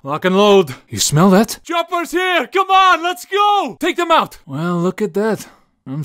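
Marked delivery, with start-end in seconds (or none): 1.6-4.4 high loud rallying yell; loud urgent call to go; excited shout. Acted. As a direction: shout the team to the chopper.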